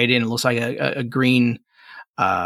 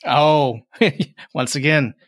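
normal talking voice

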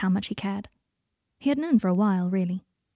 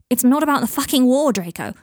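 loud, raised angry voice; exasperated outburst; 5 semitones higher, 7 dB louder than usual. Acted. Michael fed up with Draco's deflecting, exasperated and loud. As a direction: cut in loudly, fed up.

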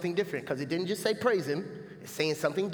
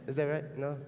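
usual speaking voice

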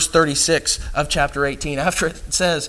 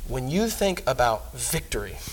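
normal talking voice

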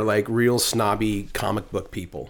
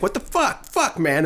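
mother in the movie; 10 semitones higher than usual. Retold or imitated. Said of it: "and she's like".

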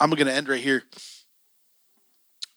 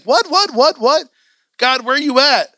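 high, louder, rapid-fire baffled 'what's; bewildered, pitched way up; alarmed disbelief; 9 semitones higher, 5 dB louder than usual. Acted. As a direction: cry out in mock panic. Panicked reaction to high prices.

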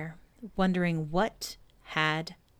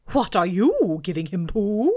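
Owl voice; 5 dB louder than usual. Acted. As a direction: boom it out like a pompous owl. Owl speaking.

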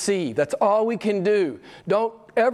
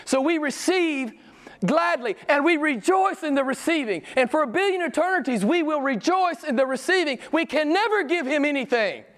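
rapturous preaching; 9 semitones higher than usual. Acted. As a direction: sing it out in wonder. Held high rapturous preaching.

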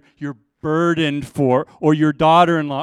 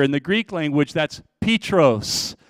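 ordinary talk

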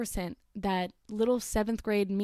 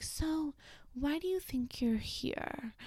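put-on much softer, slow, gentle elderly therapist; old therapist voice; 5 semitones higher, 6 dB quieter, slower than usual. Elderly therapist asking her young patient, soft and slow. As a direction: ease the question out like a careful old lady. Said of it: "she was like".

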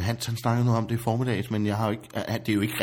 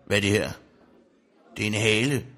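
usual speaking voice

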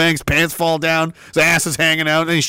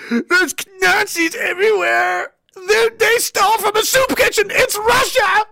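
higher, shouted mock alarm; mock hysteria; 12 semitones higher than usual. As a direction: shriek like a panicking pundit. Mocking CBC hysteria.

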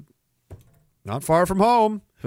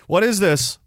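ordinary talk

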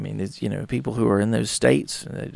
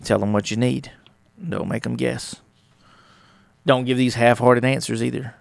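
ordinary delivery

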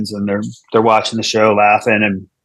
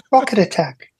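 pleasant British lady; 9 semitones higher than usual. Retold or imitated. Comedian mimicking the alarm's pleasant lady voice. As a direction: sweetly imitate the recorded alarm. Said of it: "really pleasant voice".

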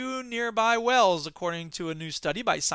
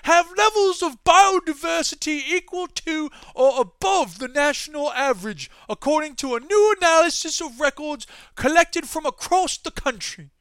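much higher, louder plummy British newsreader; British accent; 8 semitones higher, 6 dB louder than usual. Pretend. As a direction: read it like a posh BBC anchor. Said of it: "I should be reading this in a British accent".

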